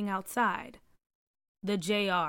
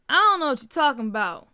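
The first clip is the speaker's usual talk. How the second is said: defiant; 4 semitones higher, 7 dB louder, faster than usual. A narrator's reading. Junior defiantly denying, chest puffed.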